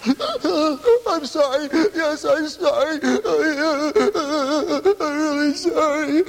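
put-on high, slow whiny child pleading sorry; mock tearful child. Boy caught stealing candy apologizes to his father, whimpering. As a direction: play the little boy faking remorse, drawn out.

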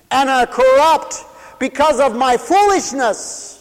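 high, loud preaching cry; full-force preaching. Acted. Preacher crying the psalm out to his radio listeners.

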